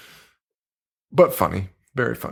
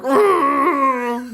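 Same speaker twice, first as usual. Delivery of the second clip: much higher mock mummy argh; mummy groan noise; 12 semitones higher than usual. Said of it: "making all these fucking noises".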